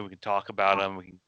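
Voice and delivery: ordinary talk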